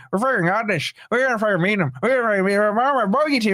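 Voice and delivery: having a bad go at an Irish accent, chanting; attempted Irish accent. Streamer mocks Chris's cocky pre-match boast in an Irish accent. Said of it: "I can't do the Irish accent".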